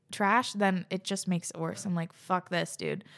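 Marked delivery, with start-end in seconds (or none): none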